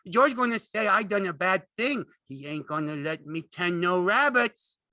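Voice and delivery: loud, high, distraught plea; frightened begging. A narrator's reading. Lenny dreads losing the rabbits, frightened begging. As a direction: wail it in fright. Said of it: "he begged".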